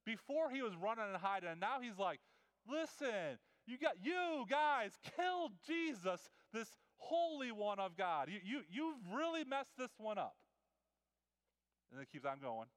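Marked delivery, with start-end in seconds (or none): 2.7-10.4 high, loud, bold calling out; bold rebuke. Retold or imitated. Peter confronting the crowd who killed Jesus, bold rebuke. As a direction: confront them boldly. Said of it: "do you see the boldness".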